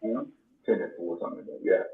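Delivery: normal talking voice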